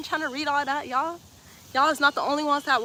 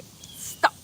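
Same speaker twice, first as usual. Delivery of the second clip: breathy whispered stop; 6 dB quieter than usual. Real, not acted. Streamer whispering a last stop to chat. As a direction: hush it out under breath.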